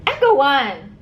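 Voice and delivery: calling the dog from across the room. Real, not acted. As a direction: raise the voice to call the pet.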